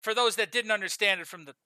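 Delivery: usual speaking voice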